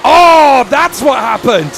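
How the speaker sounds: loud sudden oh; startled at the replay; surprised gasp. Real, not acted. Seeing crash replay.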